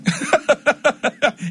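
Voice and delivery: a high burst of real laughter; amused laugh. Real, not acted. Preacher laughing at his donkey joke, high real laugh.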